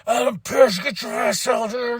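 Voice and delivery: mock angry callout. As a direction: bellow for the boss to come out. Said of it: "he's like".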